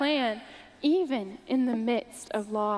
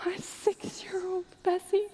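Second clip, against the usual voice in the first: a broken whisper, barely voiced; whispered grief; 5 semitones higher, 4 dB quieter than usual. Mother naming her drowned daughter Bessie, in a grieving whisper. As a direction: let the name fall to a whisper.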